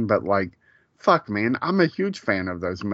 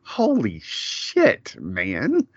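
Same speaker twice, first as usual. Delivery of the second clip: stunned realization; much slower than usual. Retold or imitated. Man rewatching a film, suddenly stunned by it. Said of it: "I'm like".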